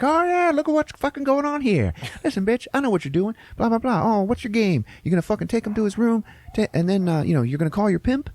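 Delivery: very high-pitched, rapid-fire bullying rant; mock aggressive confrontation. Brother confronting the hooker at the bar, mock aggressive. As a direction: barge in with hammed-up accusations.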